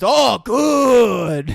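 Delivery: high, loud, drawn-out praise; hammed-up delight, stretched long; delighted sushi chef. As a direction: imitate an over-pleased language teacher.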